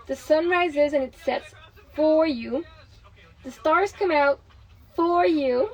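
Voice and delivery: slow, measured uplifting read, leaning on each for you; inspirational reading. Acted. Mother reading an uplifting book to her partner, slow and earnest. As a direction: let every for you land.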